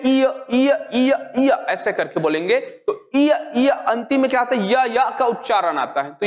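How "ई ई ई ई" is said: ई stretched and repeated, gliding toward या; sounding out ई. Shown as a demonstration. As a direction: drill the vowel ई aloud for the students. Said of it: "थोड़ा सा बोल के देखते हैं".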